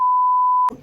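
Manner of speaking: short shrill laugh; high squealing laugh. Real, not acted.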